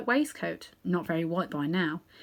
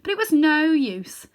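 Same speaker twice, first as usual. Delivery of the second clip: usual speaking voice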